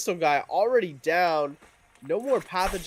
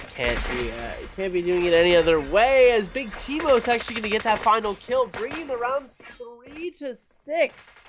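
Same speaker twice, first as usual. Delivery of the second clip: excited; 8 semitones higher, 6 dB louder than usual. Real, not acted. Caster hypes final kill.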